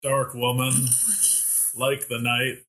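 slow, solemn poetry reading; dramatic poem reading. Acted. Brad reads his poem to Mary, slow and solemn. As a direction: recite it slow like a serious poet. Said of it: "this is my most recent poem".

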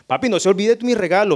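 mock child pushing for a present; child calling dad, insistent; demanding child. Child demanding gift on phone.

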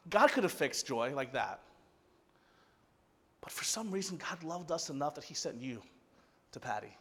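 very soft, gentle answer; tender reassurance. Retold or imitated. Pastor answering tearful Patty about Joy, soft and tender. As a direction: hush it down, letting each phrase land.

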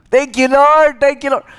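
high, loud gushing gratitude; relieved, excited thanks; overjoyed thanks. Retold or imitated.

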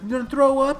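high, loud imitation; exaggerated high-pitched disbelief; mock alarmed question. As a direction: squawk it like a hammed-up startled parent. Voices family's reply.

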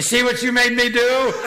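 high, loud quoted line through real laughter; mock scolding lost in giggles; laughing quote. Real, not acted. Mom's quote delivered laughing.

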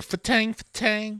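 sharp high "fa-tang" sound effects; zing it like flying knives; high knife swoosh noises. Retold or imitated. Mimicking knife throwing sounds.

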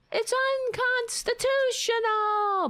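very high, screechy mock yelling. Podcaster imitates a politician yelling about the Constitution, high mock yell. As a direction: yell it high, mimicking her outrage. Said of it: "Is that what you're yelling?".

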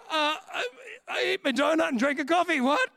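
high, loud words through laughter; laughing protest. Real, not acted. Preacher laughing that he only ate his donut, high laughing protest. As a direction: protest your innocence while cracking up.

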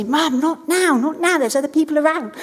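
lightly voiced five-year-old shushing Mum; embarrassed child. Mother imitating her five-year-old embarrassed by affection, mock protest. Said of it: "already get embarrassed by us".

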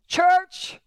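high, ringing call. Real, not acted. Preacher calling the congregation to listen, high and ringing. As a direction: call the whole church to attention.

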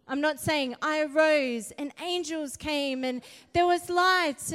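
preacher urging the congregation on; preaching. Acted. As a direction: exhort the room to arise.

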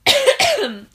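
a hacking chesty cough. Real, not acted. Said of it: "I have a cough today".

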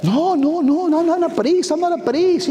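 put-on high, panicky Mexican-accented pleading; comic impression of a flustered Mexican man; imitated Mexican accent, broken English. Frightened father refusing the team leader, mock Mexican accent, high-pitched. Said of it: "spoke very little English".

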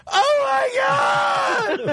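high, drawn-out mock shriek; hammed-up scream of shock; panicked kid. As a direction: wail it out like a horrified student. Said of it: "I just heard this kid go".

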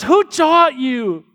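dad begging his daughter to see the lie; pleading father. Retold or imitated. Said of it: "he says".

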